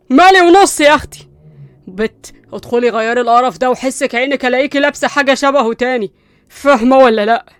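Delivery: loud threatening command; angry order. Acted. Qusay ordering Linda to change clothes, loud and angry. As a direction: bark the order at her, no arguing.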